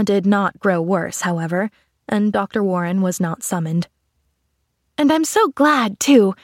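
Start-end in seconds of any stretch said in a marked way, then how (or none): 5.0-6.3 lightly voiced eager child, pitched up; a touch of girlish gladness; glad little girl. A narrator's reading. Pollyanna to her aunt that evening, mildly glad.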